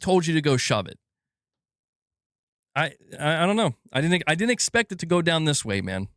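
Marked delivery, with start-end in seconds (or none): none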